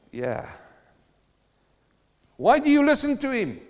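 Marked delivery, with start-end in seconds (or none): 2.4-3.6 higher, loud incredulous jeer; mock worldly scoff; scoffing sceptic. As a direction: ridicule the believer.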